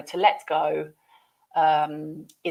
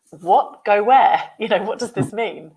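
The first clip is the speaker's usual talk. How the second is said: higher, louder puzzled questions; baffled reaction; 4 semitones higher, 10 dB louder than usual. Acted. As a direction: act out a confused listener. Voices a confused learner's reaction.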